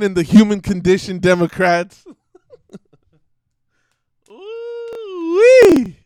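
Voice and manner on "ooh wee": a sudden, very high, loud "ooh-wee" whoop. Real, not acted. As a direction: whoop like you can't believe you said it. Whooping after his own joke.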